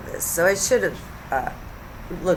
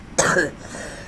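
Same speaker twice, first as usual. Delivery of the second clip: short unvoiced cough; real cough; 3 dB quieter than usual. Real, not acted. Vlogger interrupted mid-video, real cough. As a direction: cough briefly.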